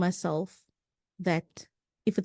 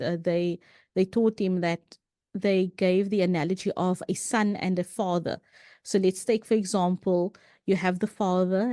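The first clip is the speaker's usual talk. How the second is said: ordinary delivery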